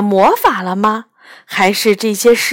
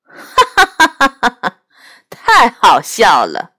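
mocking laugh; 3 dB louder than usual. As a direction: laugh him down with contempt. Bird mocks Douniu's speech.

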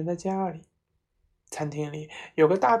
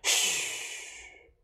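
whispered shush; urgent whispered hush; hushing shh; 3 dB quieter than usual. A narrator's reading. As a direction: hiss them quiet. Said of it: "他说".

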